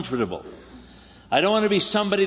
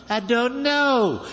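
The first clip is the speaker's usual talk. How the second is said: higher and very slow, a long weary sigh of a line; Abraham answering one more time, slow and exhausted; drawn-out weary Abraham; 6 semitones higher, much slower than usual. Retold or imitated. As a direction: stretch it out like a worn-out leader.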